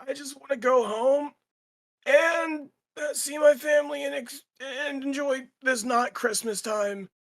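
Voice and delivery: high, pleading whine; distressed guard. Acted. Guard who saw two friends melted, distressed pleading. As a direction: whimper it in a high, pitiful voice. Game master voices traumatised fleeing guard.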